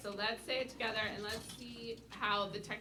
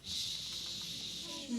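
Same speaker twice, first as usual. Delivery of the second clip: slow and drawn out; 5 semitones higher than usual. Shown as a demonstration. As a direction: say Shema slowly, breathy and unvoiced. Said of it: "say it pretty slowly".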